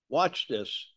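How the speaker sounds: usual speaking voice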